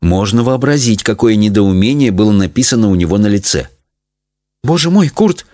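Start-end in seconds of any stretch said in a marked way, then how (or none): 4.6-5.5 higher-pitched, startled mother; frightened exclamation. A narrator's reading. Mother to her husband on seeing him shrunk, frightened. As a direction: cry out in mild fright. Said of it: "испуганно воскликнула мама".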